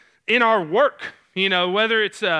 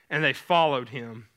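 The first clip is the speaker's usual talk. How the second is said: ordinary delivery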